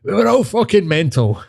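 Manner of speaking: high put-on old-guy catchphrase. Couple randomly quoting the old guy, high mock voice. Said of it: "me and my missus just randomly just go".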